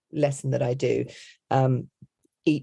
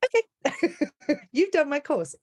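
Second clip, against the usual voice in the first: high-pitched retold surprise, breaking into laughter; amused astonishment; 13 semitones higher than usual. Coach recognising her former student, high laughing surprise. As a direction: squeak it out, taken aback and laughing. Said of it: "I was just like".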